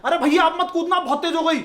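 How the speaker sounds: loud, high alarmed call; shouted warning. Retold or imitated. Station attendant yelling at a jumping passenger, loud and alarmed. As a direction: yell a warning to a stranger about to jump. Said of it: "वो चिल्लाता है".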